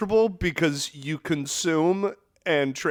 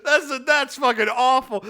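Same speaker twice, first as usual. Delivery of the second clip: ordinary talk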